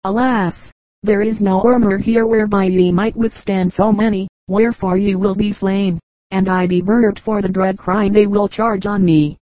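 ordinary delivery, nothing marked

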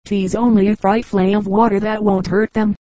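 usual speaking voice

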